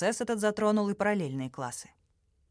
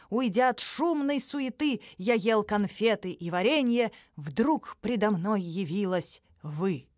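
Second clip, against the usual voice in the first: burring r; 4 semitones higher than usual. Acted. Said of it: "страшно картавя слова".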